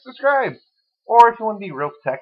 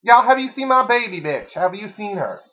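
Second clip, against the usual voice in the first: [name] voice; 5 semitones higher, 4 dB louder than usual. Acted. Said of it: "[name] enters room".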